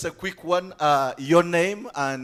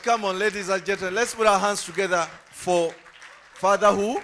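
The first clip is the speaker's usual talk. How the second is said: rallying host; 3 semitones higher, 5 dB louder than usual. Real, not acted. Host rallies applause.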